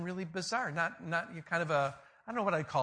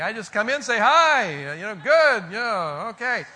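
loud, breezy mock greeting, pitched up; acted cheery greeting; 2 semitones higher, 9 dB louder than usual. Eighty-three-year-old just showing up to say hi, acted cheery. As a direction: play the easygoing old-timer greeting everyone.

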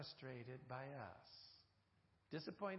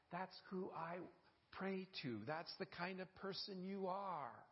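normal talking voice

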